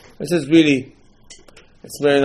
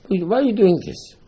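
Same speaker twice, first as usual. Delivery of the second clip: put-on high-pitched child's challenge; questioning child; 8 semitones higher than usual. Child asks Papa about the Seder, high questioning voice.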